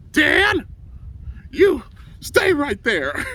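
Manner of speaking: drawn-out, high shout of outrage; retold angry yell. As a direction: yell it like a coach catching a prankster. Acted coach yelling at prankster.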